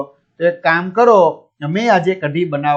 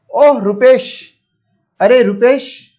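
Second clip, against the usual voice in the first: Mr Parikh greeting warmly; 5 semitones higher, 4 dB louder, slower than usual. Acted. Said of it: "mister Parikh".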